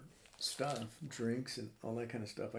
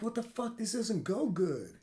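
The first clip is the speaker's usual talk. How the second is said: high, slow mock dismay; a pitched-up groan of disbelief; 9 semitones higher, 4 dB louder than usual. Man tasting too many banana peppers, high dismay. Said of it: "I'm like".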